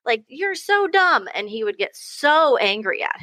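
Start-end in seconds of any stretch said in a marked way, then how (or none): 0.1-1.3 put-on high, slow, whiny kid snapping at brother; high slow mock brat voice; imitating a bratty kid. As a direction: play the son mocking his brother, high and drawn out. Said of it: "And he'd be like".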